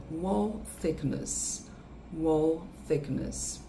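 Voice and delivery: "wall thickness" drawn out very slowly; slow English th. Shown as a demonstration. Teacher slowly modelling "wall thickness" for offshore workers. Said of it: "focar aqui é no som do T H".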